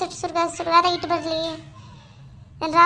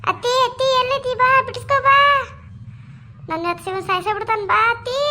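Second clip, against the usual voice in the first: frantic cry for help; 7 semitones higher than usual. Acted. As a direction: scream for help as he threatens. Wife calls mother-in-law for help. (ಅತ್ತೆಯನ್ನು ಕೂಗಿ ಕರೆಯುವ ಗಾಬರಿಯ ಕೂಗು)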